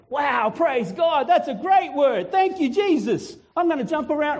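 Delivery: high, loud-hearted jubilant outburst; acted ecstatic praise, high and drawn out; excited praise. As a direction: stretch it out in overjoyed thanks. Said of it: "I'm gonna jump around and get excited".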